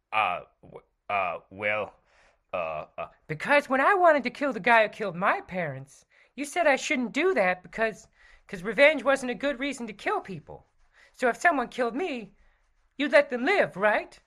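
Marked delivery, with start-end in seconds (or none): 3.3-14.2 high, bright sidekick voice; Robin voice. Acted. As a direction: play the eager young sidekick.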